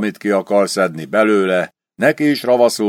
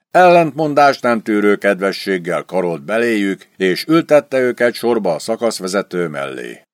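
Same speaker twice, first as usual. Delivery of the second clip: ordinary delivery, nothing marked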